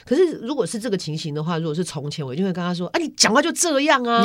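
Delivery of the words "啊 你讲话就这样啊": much higher, sharp accusing jab; snappy retort. Retold or imitated. Daughter imitating how she used to snap at her mother, sharp. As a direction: throw it back at her.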